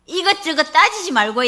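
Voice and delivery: put-on the deaconess tells the pastor with childlike simplicity; like a child. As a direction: say it simply like a child, in Gyeongsang dialect. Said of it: "이렇게 어린아이처럼 말하는 거예요".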